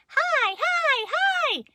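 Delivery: put-on very high, loud little-kid squeal; toddler voice. Little sister learning words, high toddler pipe. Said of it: "And Maggers said".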